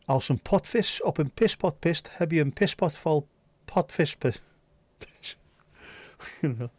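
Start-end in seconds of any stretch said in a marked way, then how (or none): 0.0-4.4 bouncy, lilting Dutch patter; rhythmic Dutch pronunciation; Dutch tongue twister. Shown as a demonstration. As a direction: recite the Dutch twister with ease. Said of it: "Dutch tongue, tongue twisters".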